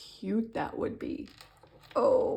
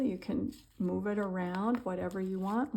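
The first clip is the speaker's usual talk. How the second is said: ordinary talk, nothing marked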